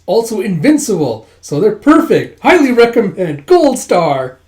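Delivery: hammed-up rave review; gushing endorsement. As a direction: build to a big enthusiastic finish. Acted sales pitch for friends.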